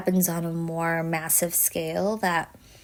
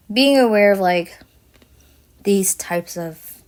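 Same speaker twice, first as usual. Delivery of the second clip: ordinary talk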